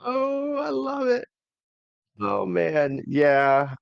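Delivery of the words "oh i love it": delighted shout. Real, not acted. Delighted reaction to feud idea.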